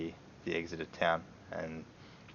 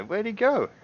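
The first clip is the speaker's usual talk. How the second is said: guard asking; 14 semitones higher, 4 dB louder than usual. Acted. GM voices guard searching yard.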